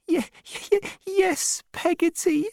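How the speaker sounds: put-on very high, stammering child's sob; sobbing. A narrator's reading. Davy answers Peggotty through the door, sobbing. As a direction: stutter it out in a tearful little boy's voice.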